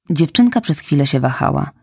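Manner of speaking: ordinary delivery, nothing marked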